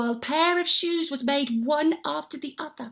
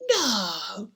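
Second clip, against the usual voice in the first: whisper; 4 semitones lower, 4 dB quieter than usual. Acted. Husband whispers answer.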